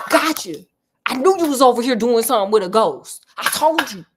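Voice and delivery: loud, triumphant pretend accusation; mock gotcha shout. Listener pretends to catch Heather with a ghost, mock triumphant shout. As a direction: burst out and catch them red-handed, playfully. Imagines jumping out to catch them.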